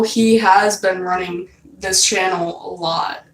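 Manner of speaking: ordinary delivery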